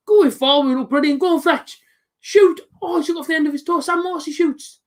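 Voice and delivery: high, rising cheer for the shot; excited urging. Real, not acted. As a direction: urge the striker on. Live chance in the match.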